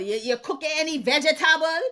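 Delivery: imitating her Antiguan mother's accent, laughing. Daughter imitating her Antiguan mother asking about dinner, laughing accent. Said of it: "'Cause even my mother".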